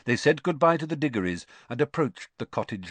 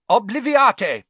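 loud, high, commanding cast; sharp spell; 12 semitones higher, 12 dB louder, slower than usual. Acted. Ministry wizard casting a memory charm on the Muggle, sharp and loud. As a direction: snap the spell out with force. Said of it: "he said sharply".

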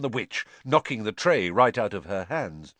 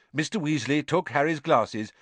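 plain reading voice

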